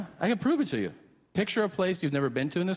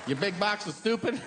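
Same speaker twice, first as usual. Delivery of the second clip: mock yelled insults; 6 semitones higher than usual. Mocking insult rant.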